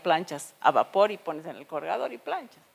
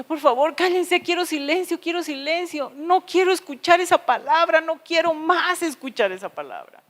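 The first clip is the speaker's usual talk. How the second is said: higher, pleading-angry plea for silence; exasperated mother; 8 semitones higher, 5 dB louder than usual. Retold or imitated. Mother telling noisy children to hush, exasperated. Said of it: "les decía".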